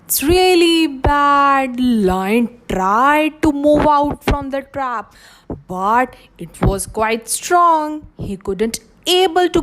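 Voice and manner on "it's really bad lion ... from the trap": usual speaking voice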